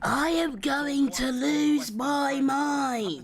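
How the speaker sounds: mock-despairing, dragged out slowly; a slow, exasperated drone; slow drawn-out despair. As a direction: stretch every word out very slowly. Repeats line in mounting frustration.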